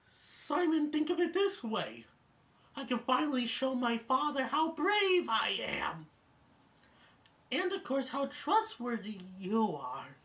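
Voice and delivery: high, earnest put-on pleading; Bambi. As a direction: coax in a high youngster's voice. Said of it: "And then Bambi would say".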